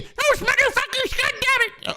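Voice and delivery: high mock British old lady, British accent. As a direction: squeal it like the outraged Queen in a British accent. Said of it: "Oh, uh, British accent".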